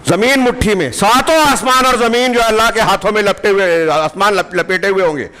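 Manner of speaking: high, forceful proclamation, easing down at the end; awestruck preaching. Acted. Preacher telling of skies held in God's hand, fervent.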